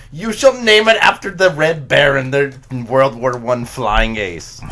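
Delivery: put-on booming German officer accent. Retelling a pizza sketch, German accent boomed out. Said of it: "speaking with a German accent".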